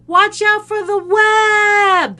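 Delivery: long, high, drawn-out yell; loud slow-motion warning cry; slow-motion scream. Retold or imitated. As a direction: scream it slowly, stretching the last word. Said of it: "as I was screaming".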